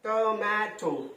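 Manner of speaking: slow three-beat "টমেটো", to, ma, to; split into syllables. Shown as a demonstration. Teacher confirming three syllables, "টমেটো" syllable by syllable. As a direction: give each syllable of "টমেটো" its own long beat. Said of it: "তিনটে syllable".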